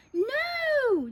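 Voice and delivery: high, long refusal; crowd yell. A narrator's reading. Crowd swears off fuzz, yelling. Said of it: "the crowd yelled".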